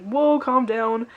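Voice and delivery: drawn-out, slow "whoa", imitating someone backing off; lightly acted plea to settle down; mock placating. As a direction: act out calming an angry friend. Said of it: "he's just, like, freaking out on Eddie".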